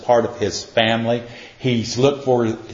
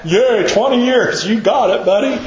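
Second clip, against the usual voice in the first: much higher, laughing mock celebration; mock cheer; 9 semitones higher, 3 dB louder than usual. Pastor teasing an old friend who finally got it, laughing mock cheer. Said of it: "I'm going".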